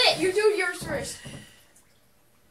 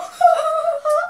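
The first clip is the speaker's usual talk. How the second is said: high sharp gasp; mock scream gasp; 9 semitones higher, 5 dB louder than usual. Mimicking his own gasp.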